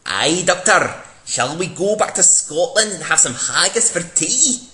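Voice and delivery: hammed-up Scottish girl; mock Scottish Amy. Amy Pond's lines in fan script.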